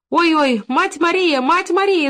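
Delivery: high, loud cry for help; high-pitched narrated outcry. A narrator's reading. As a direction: wail out to a neighbour in alarm. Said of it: "закричала Елена Александровна".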